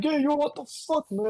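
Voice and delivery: high, brash New York yell; New Yorker voice. Retold or imitated. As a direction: shout like a street New Yorker.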